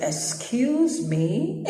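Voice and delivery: drawn-out, rising questioning tone; slow, disbelieving query climbing at the end; incredulous. Retold or imitated. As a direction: stretch it out slowly into a high, affronted question. Baffled reply to unknown caller.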